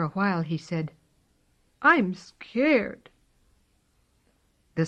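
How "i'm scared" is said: high, small and scared; a frightened little cat confessing; scared kitten voice. Acted. Kitten admits fear.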